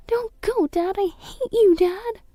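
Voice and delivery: very high tearful plea; sobbing out at her father; crying child. Acted. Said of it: "I could hear Linda crying".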